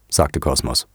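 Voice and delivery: ordinary delivery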